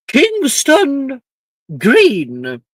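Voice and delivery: loud, high roll-call announcement; calling out a name. A narrator's reading. Headmistress summons a first year for sorting, calling out. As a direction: call the name out across a big hall. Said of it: "calling out the first name".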